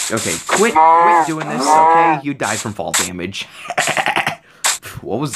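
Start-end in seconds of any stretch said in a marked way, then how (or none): 0.1-2.2 annoyed. Real, not acted. Annoyed at the game.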